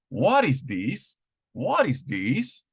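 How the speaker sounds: slow model question. Shown as a demonstration. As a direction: ask it slowly and brightly, like a drill.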